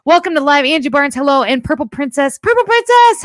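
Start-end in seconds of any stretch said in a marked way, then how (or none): 2.4-3.2 excited greeting. Real, not acted. Greeting viewer with flourish.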